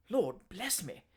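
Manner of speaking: a shocked, involuntary gasp of words; startled exclamation. Acted. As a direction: caught off guard by the threat. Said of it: "exclaimed Mister Pickwick involuntarily".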